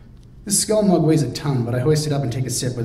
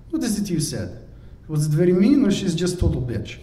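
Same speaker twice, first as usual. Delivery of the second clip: lightly voiced Radmila; 6 semitones higher than usual. Radmila's accented voice.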